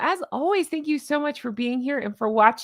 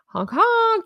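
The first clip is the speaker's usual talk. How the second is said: high playful honk honk; squeaky mock goose call; 12 semitones higher, 4 dB louder than usual.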